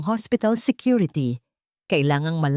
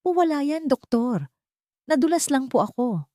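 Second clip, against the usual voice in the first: put-on higher, timid girl's voice; frightened young girl; 8 semitones higher than usual. A narrator's reading. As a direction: hide the truth in a small high voice. Frightened girl hides her bruises. (mahinhing boses ng takot na dalaga)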